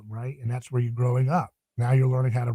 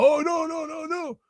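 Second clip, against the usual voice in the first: high, mocking shout of a tough-talking pundit; mocking right-wing online voice; 13 semitones higher than usual. Podcaster mocks right-wing online talkers in a high, hammed-up shout. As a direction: ham up a shouting online right-winger, much higher. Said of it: "on the right all the time online talking about".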